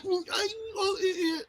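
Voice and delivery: high, broken glitchy stammer; corrupted audio. Retold or imitated. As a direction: imitate choppy, cutting-out audio. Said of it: "is what Jared was trying to say".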